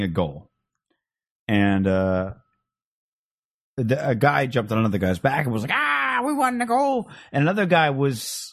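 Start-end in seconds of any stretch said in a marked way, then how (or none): 5.7-7.1 put-on high, slow Irish leprechaun accent; lilting Irish leprechaun voice. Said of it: "Why do I hear Iranians sound like leprechauns".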